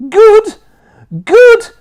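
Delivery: very high, very loud screams; screaming. A narrator's reading. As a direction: scream it twice. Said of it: "she screamed".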